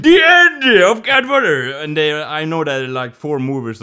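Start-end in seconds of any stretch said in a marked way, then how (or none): 0.0-1.7 announced ending. Acted. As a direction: proclaim it like a grand finale. Announces the end of film.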